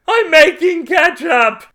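slow, piercingly high, loud shriek; high, strained, crazed yell held long; drawn-out scream. A narrator's reading. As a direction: stretch every word into a manic scream. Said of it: "he screamed again".

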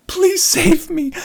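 high, slow mock plea for rescue; silent plea. Silently begging his wife to save him, high and slow. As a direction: beg with your eyes, hammed-up. Pleading eyes.

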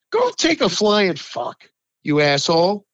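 ordinary talk

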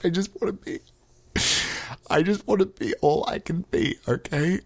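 high, soft, mock-tearful plea; fake tearful. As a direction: plead as if about to fake cry. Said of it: "I promised myself I wouldn't fake cry".

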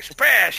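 glass smash noise. Retold or imitated. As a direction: mouth sound of a window shattering. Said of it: "They were like".

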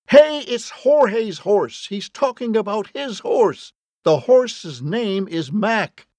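high, urgent holler, words stretched out; frantic high-pitched yelling; shouting. A narrator's reading. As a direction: keep shouting to be heard in the house. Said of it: "He shouted over and over again".

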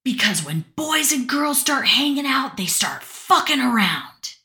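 a retold dad's outburst, half-acted; retold yell. Angry dad in the car to his daughter, retold yell. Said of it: "And he yelled".

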